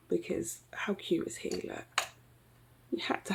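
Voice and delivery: soft whisper of delight; hushed cooing over a cute sharpener; whispered aside. Real, not acted. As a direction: whisper it like a secret.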